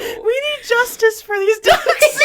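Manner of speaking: high, slow laughing plea. Real, not acted. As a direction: squeak it out through laughter.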